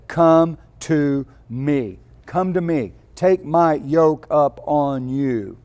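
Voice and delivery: slow, weighty Come to me; emphatic quote. Real, not acted. Preacher voicing Jesus's invitation to the weary, slow and weighty. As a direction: lean hard on each word, slowly.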